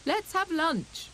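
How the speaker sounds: high, slow, eager; eager hare. A narrator's reading. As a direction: drag out the lunch call. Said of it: "called Hare".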